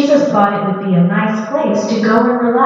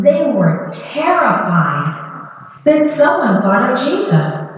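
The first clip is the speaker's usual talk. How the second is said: ordinary delivery, nothing marked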